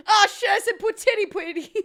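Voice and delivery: laughing through flubbed words. Real, not acted. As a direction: crack up mid-sentence. Laughs at own flub.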